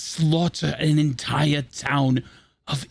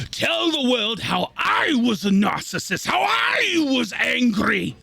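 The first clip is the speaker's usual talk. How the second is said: bitter outrage; 5 semitones higher than usual. Acted. Villain recounting what the world says of him, outraged. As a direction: voice climbing into an indignant shout.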